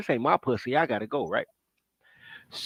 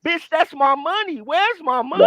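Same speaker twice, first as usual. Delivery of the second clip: angry demand; 8 semitones higher, 4 dB louder than usual. Retold or imitated. Retelling confronting his mother.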